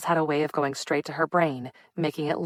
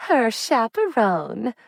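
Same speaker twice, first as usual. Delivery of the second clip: teasing lilt, much higher and slow; playful mock-formal tease; 7 semitones higher, 5 dB louder, much slower than usual. A narrator's reading. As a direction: tease her about her cousin. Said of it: "Lottie teased".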